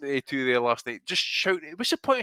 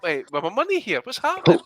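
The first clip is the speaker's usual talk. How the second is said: higher, rising to a louder exasperated demand; mock exasperated boss; 9 semitones higher, 7 dB louder, faster than usual. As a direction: play the annoyed backer asking where his money went. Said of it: "That's what DAZN's asking Eddie".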